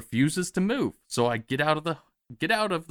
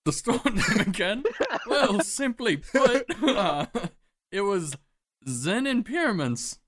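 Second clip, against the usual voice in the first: laughing; 8 semitones higher, slower than usual. Acted. Caught lying, laughing through it.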